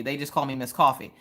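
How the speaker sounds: usual speaking voice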